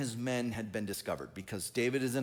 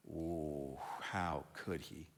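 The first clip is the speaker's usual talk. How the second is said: much softer and lower, slow scandalised hush; mock scandalised gasp; 9 semitones lower, 10 dB quieter, much slower than usual. Preacher mocking Doeg's tattling to Saul, scandalised hush. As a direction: feign shocked gossip.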